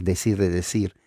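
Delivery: ordinary talk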